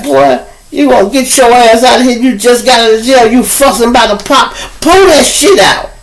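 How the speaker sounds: a cashier telling a fresh ex-con off; scolding cashier. Retold or imitated. Cashier scolds the man fussing about pop, high mock voice.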